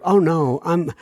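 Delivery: ordinary talk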